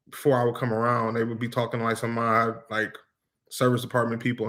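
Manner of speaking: normal talking voice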